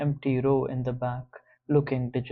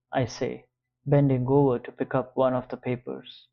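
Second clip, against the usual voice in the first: plain reading voice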